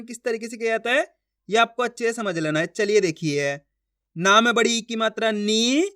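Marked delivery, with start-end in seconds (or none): 5.3-5.9 नी held long with a rising tune. Shown as a demonstration. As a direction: stretch नी out, letting it rise. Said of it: "खींच के पढ़ेंगे". (नी को खूब खींचकर)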